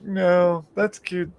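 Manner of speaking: high patronising coo; retold mock-adoring tease; teasing coo. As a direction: coo at her like she is harmless. Patronising his angry girlfriend.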